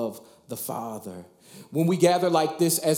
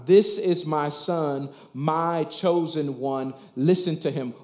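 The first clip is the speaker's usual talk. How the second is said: slow solemn repeat. Acted. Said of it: "One more time".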